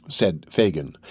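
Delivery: usual speaking voice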